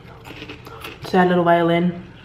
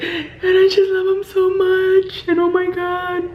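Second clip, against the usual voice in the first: much higher, slow, mock tearful lament; imitating melodramatic wail; 12 semitones higher, slower than usual. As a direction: ham it up like a weepy movie heroine. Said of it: "she even changed her voice".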